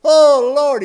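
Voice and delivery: high, loud desperate cry to the Lord; pleading cry. Acted. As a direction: wail it out as he comes down the aisle. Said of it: "and he said".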